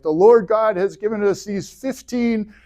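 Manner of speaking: proclaiming high and grand like Moses on the mount; booming movie Moses announcing the law; Mel Brooks Moses. Retold or imitated. Moses presents the tablets to the people, grand proclamation. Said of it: "he's like".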